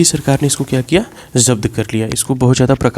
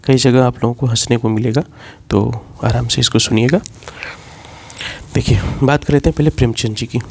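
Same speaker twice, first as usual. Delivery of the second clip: ordinary delivery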